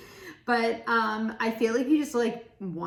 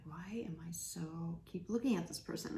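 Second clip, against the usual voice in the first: breathy whispered inner thought; hushed, secret whisper of disbelief; 8 dB quieter than usual. Pretend. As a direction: whisper it as if to yourself. Inner thought voiced to oneself.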